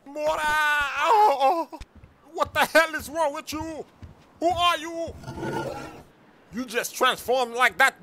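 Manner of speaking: high shocked voice; shocked friend. Acted. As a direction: recoil at the transformation. Crypto shocked.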